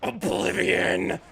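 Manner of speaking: slow drawn-out mock shout; quoted show line. Quoting a show line to chat, slow dramatic mock shout. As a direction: boom it like a dramatic villain. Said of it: "What do you want?".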